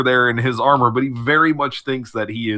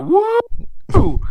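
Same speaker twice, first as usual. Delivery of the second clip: high-pitched drawn-out "woo"; mock censor whoop; 3 dB louder than usual. Joking about TV censors letting it through, high whoop. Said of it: "the censors went".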